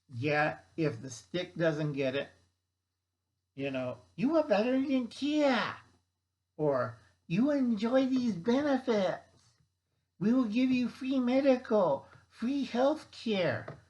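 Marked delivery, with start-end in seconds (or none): 4.1-13.8 weird recruiter voice. Retold or imitated. Government luring workers back with benefits, high mock sales voice. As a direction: sell it like a cheesy government ad. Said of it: "I can't do the weird voices".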